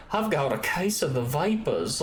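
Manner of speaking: slow Georgia drawl; Southern belle accent. Shown as a demonstration. Georgia native demonstrating home dialect, Southern belle drawl. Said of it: "that's more Southern from where I'm from".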